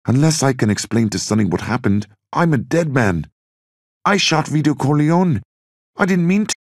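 climbing into desperate fear; a doomed man blurting it out; panicked confession. Acted. Bobby panics about being dead.

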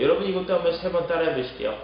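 ordinary talk

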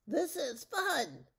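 high, loud, stretched-out singsong glee; gleeful chiming. A narrator's reading. Witch jumps out of the way with the wand, high gleeful chime.

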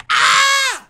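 long, loud, piercing scream; sustained very high "ah". Acted. Player reacting to a lost race, long high scream.

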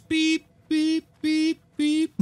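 high, even truck-reversing beeps; high-pitched vocal beep; imitated beeping. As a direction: beep like a vehicle backing up. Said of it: "listen for the beeping sounds".

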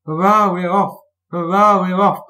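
high, loud, jubilant puffs. A narrator's reading. Thomas getting away from the signal, gaily cheering. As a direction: cheer it out in merry bursts. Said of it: "puffed Thomas gaily".

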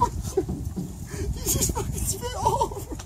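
breathless laugh-crying; laughing to tears. Real, not acted. Laughing after being spat on.

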